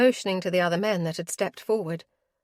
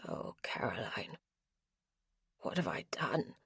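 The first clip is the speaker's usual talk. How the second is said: unvoiced and much softer; grieving whisper; 10 semitones lower, 12 dB quieter than usual. Ro kneeling over Caroline, grieving whisper. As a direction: break down in a whisper.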